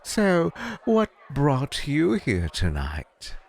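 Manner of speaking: theatrical hostess, high and slow, with stressed key words; proud bar owner. Acted. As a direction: play the proud proprietress selling her specials. Voices the bar owner Clarissa.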